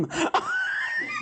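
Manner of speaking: a very high shrieked laugh; shrieking laugh. Real, not acted. Laughing after rant.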